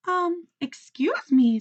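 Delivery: high, slow, polite little bird voice; a shy sparrow interrupting politely; timid little sparrow. A narrator's reading.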